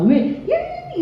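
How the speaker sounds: extremely high imitation holler; high squeal without extra volume; mock yell. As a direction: mimic a parent shrieking at a child. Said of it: "이러고 소리 왜 질러?".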